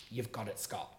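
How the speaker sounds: low, soft words to himself; quiet self-talk. Retold or imitated. Quiet self-talk after the trauma.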